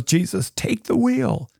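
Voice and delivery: lightly sung high plea; a hint of a country singer begging; frustrated plea. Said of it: "She's this all frustrated, needs help, and says".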